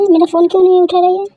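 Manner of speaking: snapping at friends on the phone; annoyed scolding. Acted. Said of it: "इतना गुस्सा क्यों कर रही है".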